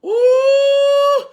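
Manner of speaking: sudden high preacher's "Ooh"; ecstatic high cry. Real, not acted. Preacher crying out to the congregation, high ecstatic whoop. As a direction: cry out high and loud in the spirit.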